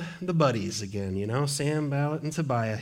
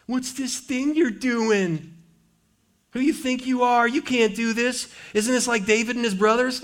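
higher, sneering mockery of the enemies; mocking taunt; 7 semitones higher than usual. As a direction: taunt him like a jeering crowd.

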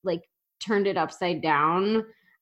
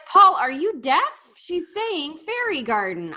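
higher-pitched, incredulous retort; mock exasperation at Paul; 8 semitones higher than usual. Host retorting to the TV judge who misheard, mock exasperated. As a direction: act out exasperated disbelief.

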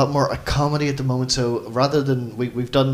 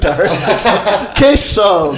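loud, higher, laughing proclamation; mock detective announcement; 7 semitones higher, 9 dB louder, much slower than usual. Host teasing a player as Detective Darren, mock triumphant announcement.

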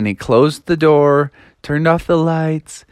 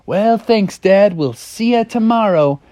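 put-on higher-pitched kid frog voice; a child saying goodnight to Dad; lightly put-on high little voice; young Froggy; 5 semitones higher than usual. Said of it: "And Froggy was like".

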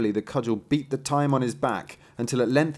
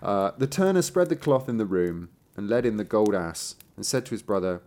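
plain reading voice

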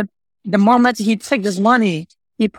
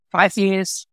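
usual speaking voice

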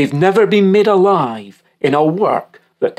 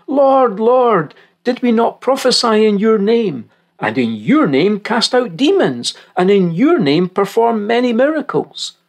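higher-pitched quoted appeal; many pleading with the Lord; 8 semitones higher than usual. Acted. Rejected many protesting to Jesus on that day, higher appeal. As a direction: plead upward as the rejected crowd. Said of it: "Many will say to me on that day".

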